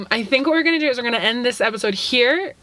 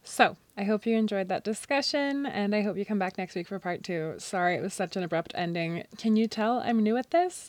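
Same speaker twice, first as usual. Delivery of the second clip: soft, close outro voice; podcast outro; 10 dB quieter than usual. Acted. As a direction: sign off quietly to listeners. Recorded outro, softer.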